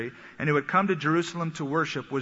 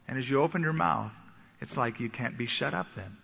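ordinary talk, nothing marked

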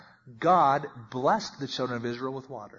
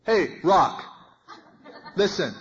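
mock-earnest call to a rock, a bit louder; Moses talking to a rock; 3 semitones higher, 3 dB louder, slower than usual. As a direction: call out to the rock like it can hear, half laughing. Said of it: "And can you imagine?".

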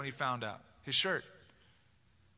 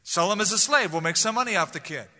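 callous brother; 4 semitones higher, 6 dB louder than usual. Retold or imitated. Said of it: "In fact, Judah said".

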